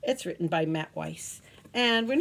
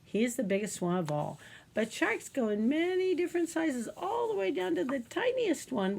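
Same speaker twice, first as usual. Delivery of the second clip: ordinary talk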